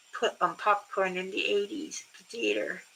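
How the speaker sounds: normal talking voice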